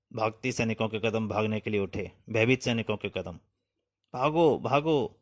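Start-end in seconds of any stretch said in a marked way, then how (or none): 4.1-5.2 slow, drawn-out calls; calling out to flee. Acted. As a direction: stretch each word like a distant warning cry. Panicked soldiers calling out to flee.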